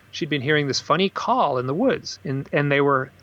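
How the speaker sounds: ordinary talk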